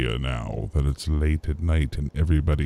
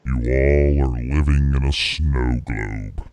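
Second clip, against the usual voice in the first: booming God voice. Pretend. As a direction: speak down from the heavens. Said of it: "I am God".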